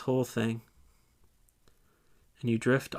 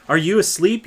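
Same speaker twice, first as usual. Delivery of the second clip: louder, higher, urgent question; urgent wake-up call; 4 semitones higher, 8 dB louder than usual. Acted. Leader waking the dozing disciple, urgent loud call. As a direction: rouse a sleeper sharply.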